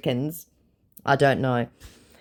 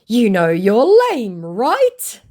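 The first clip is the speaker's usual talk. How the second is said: mocking scammer voice; 8 semitones higher, 8 dB louder, much slower than usual. Reading the scammer's reply.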